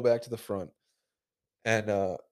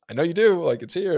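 louder, higher, insisting; insistent retort; 7 semitones higher, 8 dB louder than usual. Retold or imitated. Arguing with the cashier, insisting louder. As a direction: push back hard at her.

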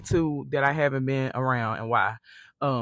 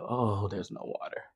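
normal talking voice

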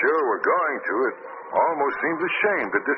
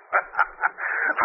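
breathy gloating laugh. Acted. Villain gloats.

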